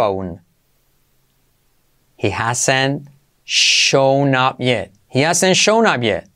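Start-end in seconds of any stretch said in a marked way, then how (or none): 2.2-4.9 very slow, every word stretched. Shown as a demonstration. Teacher to students, very slow dictation pace. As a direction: dictate it slowly for learners. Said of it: "slow model then normal".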